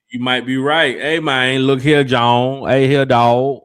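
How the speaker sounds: reading a chat message in a loud Memphis voice; Memphis talk. Retold or imitated. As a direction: stretch out look here John the Memphis way. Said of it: "John Person said".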